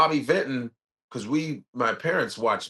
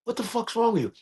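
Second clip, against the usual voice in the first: angry; 10 semitones higher than usual. Retold or imitated. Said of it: "I go".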